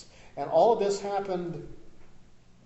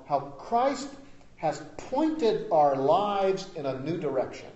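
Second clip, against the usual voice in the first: ordinary talk, nothing marked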